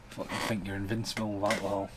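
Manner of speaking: usual speaking voice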